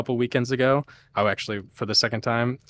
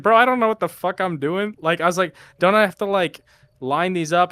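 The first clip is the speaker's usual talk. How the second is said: retold confusion; 7 semitones higher, 4 dB louder than usual. Retelling own confusion.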